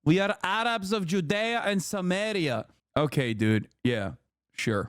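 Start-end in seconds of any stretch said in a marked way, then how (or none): 0.0-2.7 mock Arabic accent. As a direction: parrot the guest's Arabic-accented words. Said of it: "He just sounds like that".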